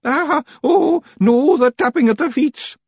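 high, loud Scottish wail; dismayed Feegle. A narrator's reading. Feegles dreading the tapping of the feet, dismayed wail.

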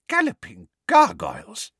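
startled, high, loud exclamation; shocked cry. A narrator's reading. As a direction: yelp in shock. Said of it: "leapt backwards in shock".

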